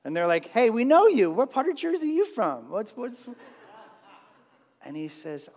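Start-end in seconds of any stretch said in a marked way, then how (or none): 0.5-3.3 loud, higher mock Jersey greeting; mock Jersey accent. Danites greeting the Levite in a mock Jersey accent. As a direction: hail a fellow Jersey guy in a hammed-up Jersey accent. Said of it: "a Dan-like accent".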